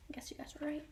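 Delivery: hushed unvoiced aside; whisper. Real, not acted. Teacher telling the class they guessed right, a whisper.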